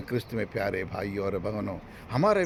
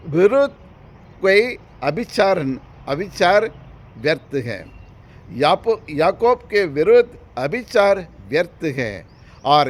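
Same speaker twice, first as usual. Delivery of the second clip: raised, loud, deliberate phrase-by-phrase delivery; emphatic scripture recitation; 5 semitones higher, 8 dB louder than usual. Acted. Preacher citing scripture against sorcery, emphatic recitation. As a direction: proclaim the verse, pausing after each chunk.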